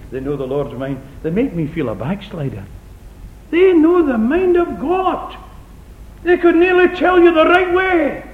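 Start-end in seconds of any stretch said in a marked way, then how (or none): 3.5-8.2 high, loud, slow preaching cry; loud sarcastic preaching; preaching at full force. Acted. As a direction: ring it out with mock wonder. Mocking the overconfident.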